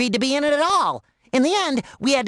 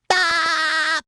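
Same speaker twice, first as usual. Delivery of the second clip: high, held cartoon cry; drawn-out, high-pitched yelp; sustained yelp; 7 semitones higher than usual. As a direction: let out a long high "aah". Character's wordless cry at own joke.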